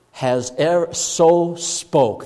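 slow, leaning on "so"; emphatic so. Shown as a demonstration. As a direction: draw out "so" to mark degree. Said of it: "That's the idea".

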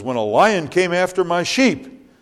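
high, loud bold boast; proud shepherd boy telling his feat; David to Saul's men, boasting loudly; young David boasting. Acted.

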